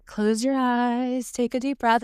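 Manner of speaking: put-on guided meditation lilt; imitated meditation guide. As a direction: draw it out soothingly like a meditation leader. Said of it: "like, in meditations and shit that".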